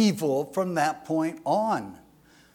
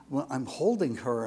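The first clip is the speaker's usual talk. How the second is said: ordinary talk, nothing marked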